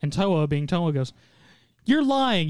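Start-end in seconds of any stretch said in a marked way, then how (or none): 1.8-2.5 higher-pitched mock accusation; retold accusation. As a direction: call out a liar, pitched up. Acted accusation of a villain.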